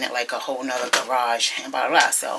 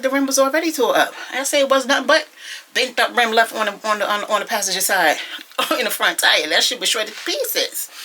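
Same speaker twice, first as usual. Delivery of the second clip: retold retort; 7 semitones higher, 4 dB louder than usual.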